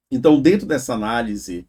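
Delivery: usual speaking voice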